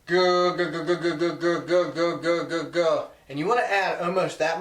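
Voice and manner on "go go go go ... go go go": "Go" repeated fast, loud and growly; rapid goose moan. Shown as a demonstration. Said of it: "you're gonna go".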